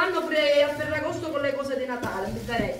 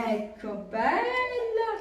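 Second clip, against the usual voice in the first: ordinary talk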